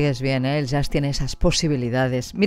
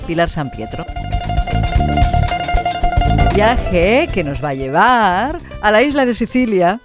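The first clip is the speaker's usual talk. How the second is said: radio presenter intro; 2 semitones higher, 4 dB louder than usual. Real, not acted. Host introducing Antus and his return to the programme, radio presenter intro. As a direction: draw out key words to welcome the guest.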